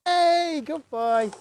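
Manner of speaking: high, bright squeal of praise for the dog; excited dog praise. Real, not acted. Trainer praising her dog for getting it right, in a high excited squeal. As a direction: cheer him on, way up high and loud.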